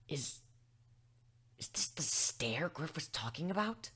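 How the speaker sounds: a cowed griffon whispering under the stare. Acted. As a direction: whisper it to yourself in awe. Awed aside under stern stare.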